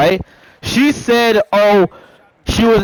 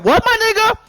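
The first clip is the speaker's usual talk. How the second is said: high, incredulous acted question; imitated disbelief; 11 semitones higher than usual. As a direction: pitch leaps way up in shock. Friend reacts to alarming news.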